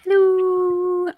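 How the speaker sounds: high, drawn-out hello; high silly hello. Acted. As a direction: chirp it in a high voice. Co-host answers in put-on voice.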